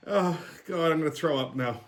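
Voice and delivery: normal talking voice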